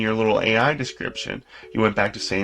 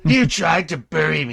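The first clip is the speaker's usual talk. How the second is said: dramatic villain monologue; 5 semitones higher than usual. Acted.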